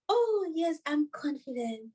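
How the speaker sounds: high then fading, half-hearted "Oh, yes"; a meek, doubtful claim of confidence; mock timid answer. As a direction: play the unconvincing meek reply. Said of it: "we can't really say".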